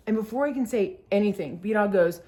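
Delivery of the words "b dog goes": normal talking voice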